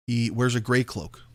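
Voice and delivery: ordinary delivery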